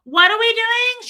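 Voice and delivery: high, loud, drawn-out holler; yelling from afar. Retold or imitated. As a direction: imitate mom hollering across the store. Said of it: "so far away from me".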